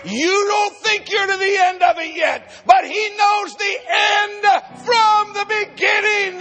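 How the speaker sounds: ordinary talk, nothing marked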